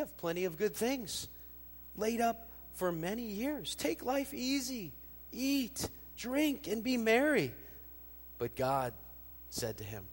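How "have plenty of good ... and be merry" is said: smug rich man. Acted. Rich fool telling himself to take life easy, high and gloating. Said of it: "and I'll say to myself".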